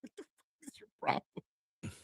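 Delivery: very high, hushed disbelief; retold outrage. Dad to son who farted on him, appalled. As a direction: act out stunned, squeaky outrage under your breath. Retelling outrage at son's prank.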